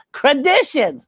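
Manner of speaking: high puppet voice saying "Traditions" carefully; puppet repeating word. Acted. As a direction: puppet gets "Traditions" right. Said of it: "Yes, traditions".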